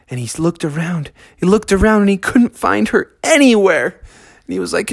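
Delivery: ordinary delivery, nothing marked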